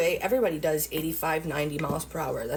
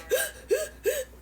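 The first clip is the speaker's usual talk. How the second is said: a sharp, very high crying gasp; high sobbing gasp. Retold or imitated. Replaying her crying fit after the traffic stop, high sobbing gasp. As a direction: imitate a panicked sob catching in the throat. Describing her breakdown in car.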